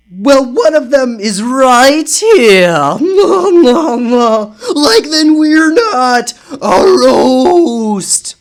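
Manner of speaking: slow, high, loud terrified cry. Acted. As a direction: panic as the ghost appears. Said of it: "I'm doing different voices for different people".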